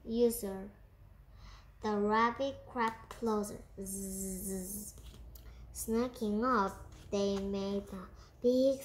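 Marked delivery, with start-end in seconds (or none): 3.8-4.9 soft buzzing snore, like someone sound asleep; quiet storybook snoring noise; snoring zzz. A narrator's reading. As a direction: imitate a sleeper with a hushed, drawn-out zzz. Sound of the sleeping crocodile.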